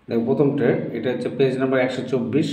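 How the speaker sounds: ordinary talk, nothing marked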